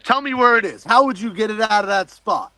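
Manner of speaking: high, insistent questioning; incredulous challenge. Retold or imitated. Diver testing his friend's claim about the compass, incredulous. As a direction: demand proof with the pitch jumping up.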